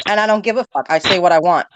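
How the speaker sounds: ordinary delivery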